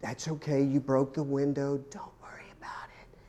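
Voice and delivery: a far too gentle mother letting it slide; mock soft mom. Pretend mom shrugging off the broken window, fading to whisper. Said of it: "when your mom got mad".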